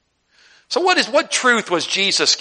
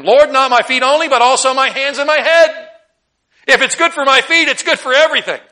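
higher, louder, all-or-nothing eagerness; Peter's eager overreaction; 9 semitones higher, 8 dB louder than usual. Retold or imitated. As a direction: Peter begging to be washed head to toe. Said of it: "says unto him".

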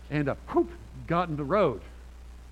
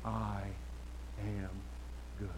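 softer, lower, words spaced far apart like a distant voice; faint fading yell; 7 semitones lower, 11 dB quieter, slower than usual. Retold or imitated. Preacher recalling the yelling heard from down the street, faint and spaced out. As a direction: let it trail off as you walk away. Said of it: "he's still screaming on his lawn".

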